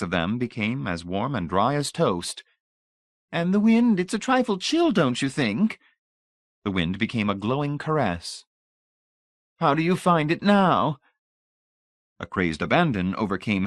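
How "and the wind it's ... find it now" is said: put-on high, honeyed old lady voice; sugary old lady. A narrator's reading. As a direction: pour on the sweetness like a grande dame. Voices Mrs Pritchett.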